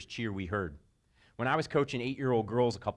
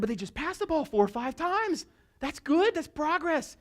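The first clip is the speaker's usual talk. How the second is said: high, cheerful insistence; proud reply; 3 dB louder than usual. Retold or imitated. Coach answering the rival coach, high and proud. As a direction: defend the progress happily. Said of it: "I said".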